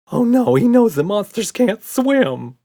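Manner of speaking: horrified gasp; gasping in fright. A narrator's reading. Red hears monsters can't swim, loud gasp. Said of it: "gasped Red".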